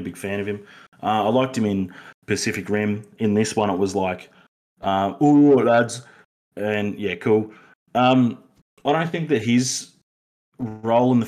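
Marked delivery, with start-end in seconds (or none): 5.2-6.0 mock bloke voice. Mocking the actor's line.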